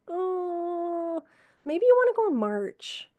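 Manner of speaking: high, light pointed hint; hinting suggestion. Retold or imitated. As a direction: nudge him gently but pointedly. Nurse hinting to dying patient.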